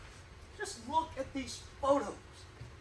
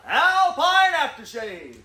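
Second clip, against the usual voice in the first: big, slow, loud ad read; commercial announcer voice; 2 semitones higher, 11 dB louder, much slower than usual. Shown as a demonstration. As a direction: sell it like a TV spokesman. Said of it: "would you say it?".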